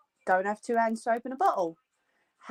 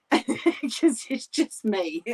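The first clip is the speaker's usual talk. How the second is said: laughing; 2 semitones higher, much slower than usual. Real, not acted. Laughing self-mockery.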